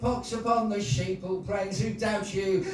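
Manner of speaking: poem recital. Acted. Poet closing a poem for the audience, high-pitched recital. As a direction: deliver the rhyming lines as a performance.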